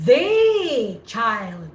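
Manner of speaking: "The" drawn out as a long "thee"; emphatic prolonged THE. Shown as a demonstration. Teacher showing how to emphasize something, "The" prolonged as "thee". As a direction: stretch "The" before "child" as "theee". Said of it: "because you are prolonging it".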